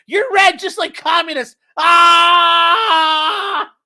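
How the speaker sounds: high, loud mock outrage ending in a scream; hammed-up furious yell. Shopper accusing the lobsters, hammed-up screaming outrage. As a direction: build absurd accusation into a long shriek. Acting out hatred on seeing lobsters.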